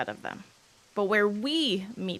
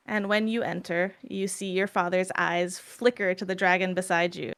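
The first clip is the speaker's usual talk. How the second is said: ordinary delivery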